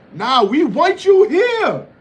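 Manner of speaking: high, slow mock plea; mock pleading. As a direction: beg him to stay, hammed up. Mocking troll, begging him stay.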